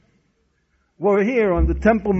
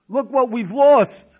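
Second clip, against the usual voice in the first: louder, higher, drawn-out plea; retold impassioned lament; 4 semitones higher, 7 dB louder than usual. Companions showing Akiva the destruction, acted impassioned plea. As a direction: press the loss home, stretching the words.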